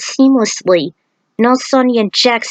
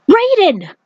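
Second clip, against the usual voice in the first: alarmed cry; 11 semitones higher than usual. Acted. Lao sees Raiden collapse.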